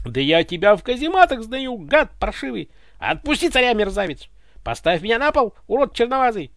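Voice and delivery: high, loud furious squawking; screeching threats. A narrator's reading. Ivan struggles in the guard's grip, screeching. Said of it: "верещал Иван".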